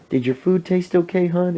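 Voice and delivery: high, put-on sweet server voice; imitated waitress. Waitress checking on a customer, high put-on voice.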